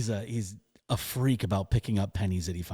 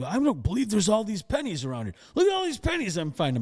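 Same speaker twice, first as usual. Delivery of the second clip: much higher, delighted dad voice; amazed dad; 9 semitones higher than usual. Retold or imitated. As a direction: play the dad thrilled at his luck. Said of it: "my dad is going around and picking up".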